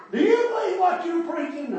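loud, high challenge in his face; confrontational shout. Retold or imitated. Angry man in the preacher's face, loud and high. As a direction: get right up in his face and demand it.

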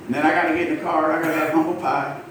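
ordinary delivery

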